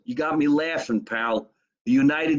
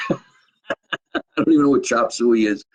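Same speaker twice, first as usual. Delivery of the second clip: laughing; 5 dB louder, slower than usual. Real, not acted. Cracks up after his joke.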